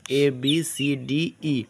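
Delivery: slow, recited ए बी सी डी ई; slow teacher recitation; English letter names. Shown as a demonstration. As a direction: chant the alphabet to show ई. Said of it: "ई का sound देगा".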